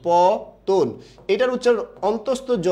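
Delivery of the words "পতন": পতন split into long paw and ton; model pronunciation. Shown as a demonstration. Teacher showing how it sounds, slow model syllables. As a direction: stretch each syllable of পতন slowly, rounding the second vowel. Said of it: "এরকম হবে".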